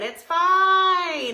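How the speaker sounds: high, loud, breezy it's fine; breezy dismissal. Retold or imitated. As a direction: brush it off brightly. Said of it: "I'm like".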